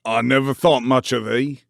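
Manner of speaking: loud, gruff Yorkshire rant; indignant old man ranting down at a girl; haranguing old gardener. A narrator's reading. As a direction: scold her from the ladder. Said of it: "he harangued".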